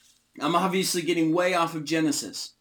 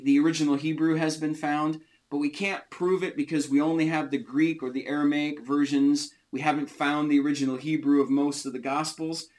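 normal talking voice

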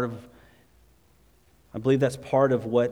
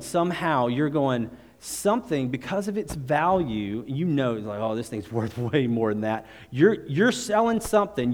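excited climb; 6 semitones higher, 5 dB louder than usual. Real, not acted. Preacher builds on selling for value.

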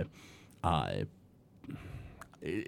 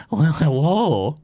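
smirking kobold; 4 semitones higher, slower than usual. Acted. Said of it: "He goes".

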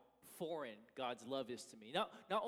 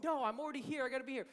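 indignant insistence; 10 semitones higher, 4 dB louder, faster than usual. Retold or imitated. Retold stubborn reply.

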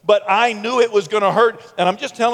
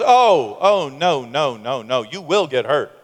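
matter-of-fact buddy; 4 semitones lower, slower than usual. Retold or imitated. Buddy's deadpan correction.

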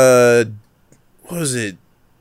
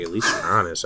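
ordinary delivery

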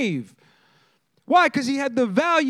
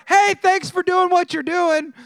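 slow, loud, sarcastic thank-you note; mock thank-you; 6 semitones higher, 4 dB louder, slower than usual. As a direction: ham up a fake grateful card. Mock Christmas card to IRS.

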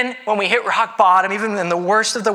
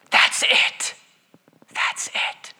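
intense hushed whisper; all breath, no voice; whispered approval; much slower than usual. Acted. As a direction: whisper it slowly, savoring the discovery. God's approval, dropped to whisper.

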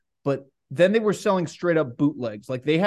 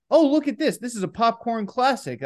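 mock delight; 6 semitones higher than usual. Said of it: "I was like".

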